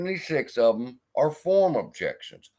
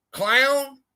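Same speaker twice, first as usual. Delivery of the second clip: mocking; 12 semitones higher than usual. Real, not acted. Mocking the streamer's insult.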